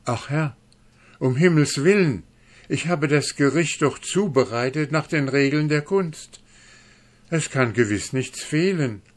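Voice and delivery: high, pleading in fear; frightened pleading. Acted. Dwarf begs the angry duke, terrified. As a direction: beg your master for your life. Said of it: "so sprach der Zwerg und zitterte".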